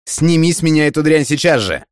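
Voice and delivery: high, strained angry outburst; indignant demand. A narrator's reading. Netted man demanding to be freed, high indignant cry.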